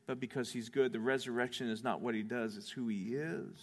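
soft, hushed, earnest preaching; gentle preaching appeal. Real, not acted. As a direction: speak softly to the weary listener.

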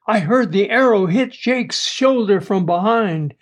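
plain reading voice

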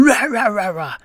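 high mock barks, rah rah; imitating the excited dog; dog barking. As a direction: bark it out like the dog. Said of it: "my dog started going".

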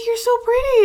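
very high imitation of a doting dad; acted-out fatherly compliment; reassuring dad. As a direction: insist on it, high and gushing. Imitates dad reassuring crying daughter.

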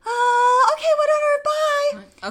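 very high, loud panicked squeal; flustered caving in. Retold or imitated. Retelling agreeing to a call from the lady's son, flustered. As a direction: squeak it out to escape the conversation.

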